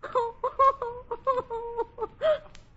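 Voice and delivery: high stagey tittering laugh; hammed-up tinkling laugh; forced stage laugh. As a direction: fake a brave laugh through tears. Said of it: "Laugh. That's what I should do".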